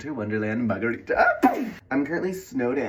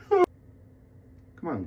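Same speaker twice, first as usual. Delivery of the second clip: ordinary talk, nothing marked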